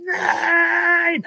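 high wailing no; crying no. Pretend. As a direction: cry out no in despair. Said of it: "und würde sagen".

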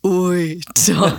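low, sneering, condescending drawl; condescending Scar voice. Retold or imitated. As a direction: sneer it like a smug lion villain. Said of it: "Ich wiederhole".

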